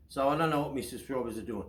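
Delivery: usual speaking voice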